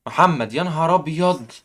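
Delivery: ordinary talk, nothing marked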